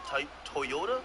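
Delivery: Toyota tried out as "Toy-ota"; others' pronunciation. Retold or imitated. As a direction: break off on "Toy", then ask "Toy-ota". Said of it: "y'all say it?".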